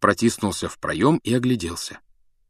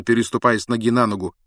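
ordinary delivery

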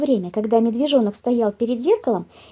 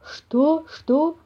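raised, baffled question; puzzled bear; 5 semitones higher than usual. A narrator's reading. Papa bear looking round, puzzled. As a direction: ask it bewildered, unsure who spoke. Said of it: "не понял папаша Бамсе".

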